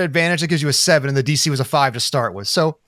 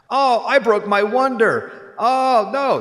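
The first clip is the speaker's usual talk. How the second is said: laughing mock despair; 7 semitones higher, much slower than usual. Kid overacting distress.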